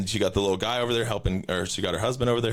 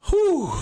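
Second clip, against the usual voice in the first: loud high whew; 6 dB louder than usual. Real, not acted. Exhale after the rant.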